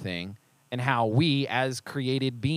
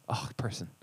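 whispered; 6 semitones lower, 8 dB quieter than usual. Real, not acted. Exasperated aside after the mock.

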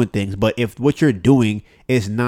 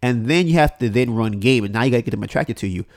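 ordinary talk

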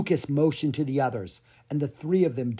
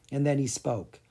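plain reading voice

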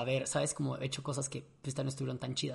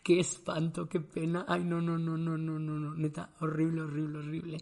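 loud outcry trailing into muttered repeats; re-enacted cringing embarrassment, slow then tumbling; mortified; 4 dB louder than usual. Realizing he broke the cruise dance floor, mortified. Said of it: "la pena que me dio".

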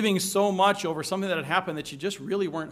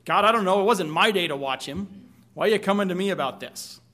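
louder, snippy and defiant; arrogant retort; 3 semitones higher, 7 dB louder than usual. Retold or imitated. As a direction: brush God off like a smart-mouthed kid. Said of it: "there's arrogance in there as well".

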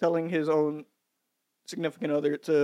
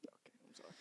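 barely audible whisper; 35 dB quieter, much faster than usual. Real, not acted. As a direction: whisper an apology.

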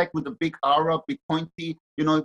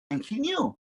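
ordinary delivery

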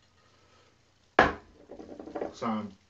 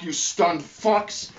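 slow, loud, higher spat-out insult; yelled insult; 7 semitones higher, 6 dB louder, much slower than usual. Real, not acted. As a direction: yell it at them with contempt.

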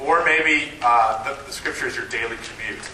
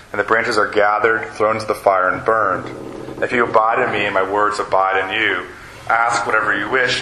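louder, measured public Bible reading; formal reading of scripture; 4 dB louder than usual. Acted. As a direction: read the passage out to the room. Reads John 15 aloud.

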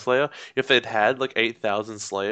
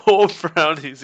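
louder, drawn-out laughing repeat; 5 semitones higher, 7 dB louder, slower than usual. Real, not acted. Cohost echoing the brownies joke, laughing. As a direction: repeat it through a big laugh. Laughing along at the other's correction.

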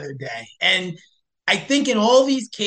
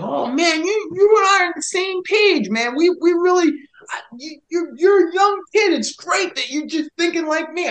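excited gushing; 11 semitones higher than usual. Retold or imitated. Retelling the political hiker agreeing with him, higher and excited. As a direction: gush like you've found a kindred spirit. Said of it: "He got all excited".

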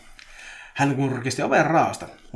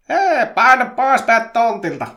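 loud, high angry shout; huuto; 11 semitones higher, 12 dB louder, slower than usual. A narrator's reading. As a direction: yell it at someone in your yard. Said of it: "Petri huusi". (kova, korkea huuto)

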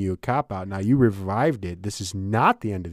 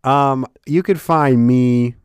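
mock outro; 4 semitones higher, 8 dB louder, slower than usual. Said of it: "doing different things to throw you off".

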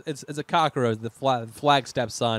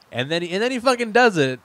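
louder and higher, building disbelief; incredulous outburst; 5 semitones higher, 8 dB louder than usual. Real, not acted. Host ranting to cohost about a pointless death, loud and incredulous. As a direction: rant as if the plot betrayed you. Outraged at pointless plot twist.

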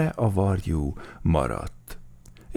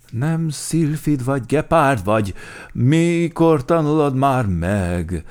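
raised, exasperated scolding; cross; 7 semitones higher, 6 dB louder than usual. A narrator's reading. Bear correcting the cheetah yet again, crossly. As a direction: scold loudly, stretching the last question. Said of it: "mérgelődött Medve Medárd".

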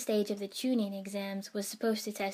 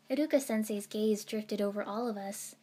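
ordinary delivery

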